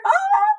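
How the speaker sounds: high playful fright cry; mock scared gasp. Teacher acting scared of predators for kids. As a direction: pretend to be startled by a lion.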